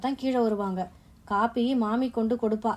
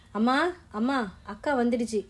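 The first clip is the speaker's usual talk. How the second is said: calling out. A narrator's reading. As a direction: call out to mother with news, voice lifting. Boy runs in calling his mother.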